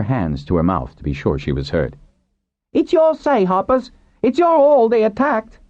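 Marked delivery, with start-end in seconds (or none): 2.7-5.6 loud, very high crowd voice; calling out. A narrator's reading. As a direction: call across the court to the Harpers. Crowd member calls to Harpers.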